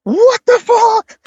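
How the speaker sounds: high, drawn-out mock horror; mock shock. Man retelling his reaction to demon voice, high shocked cry. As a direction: squeal it slowly in exaggerated disbelief.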